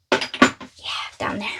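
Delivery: whispered aside. Real, not acted. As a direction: murmure à voix basse, en aparté. Aside while putting box away.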